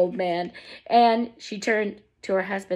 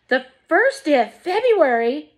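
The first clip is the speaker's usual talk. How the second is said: high, loud startled cry; cried out; 6 semitones higher, 5 dB louder than usual. A narrator's reading. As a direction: cry out the date in alarm. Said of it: "cried Missus Bucket".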